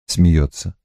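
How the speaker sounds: ordinary delivery, nothing marked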